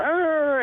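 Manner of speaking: high siren wail for a police car; imitated siren. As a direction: mimic a cop car's siren. Police car coming up behind.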